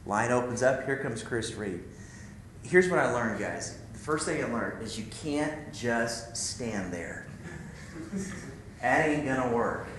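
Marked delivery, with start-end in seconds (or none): none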